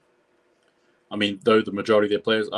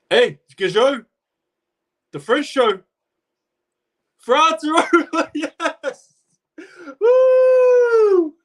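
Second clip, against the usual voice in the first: much higher, louder, screaming with joy; ecstatic shout; 7 dB louder, much slower than usual. Real, not acted. Commentator to viewers as France scores, ecstatic high yell. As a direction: erupt as the try goes in.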